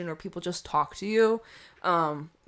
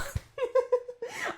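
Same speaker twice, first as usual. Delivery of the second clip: high breathy giggle; real laugh. Real, not acted. Card reader gets the lyric wrong, giggling. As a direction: burst of giggling at yourself.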